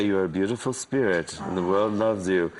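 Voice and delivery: low, soothing mock guru. Soothing a depressed friend in guru voice. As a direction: comfort them like a gentle mystic. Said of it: "Can you call me with the voice?".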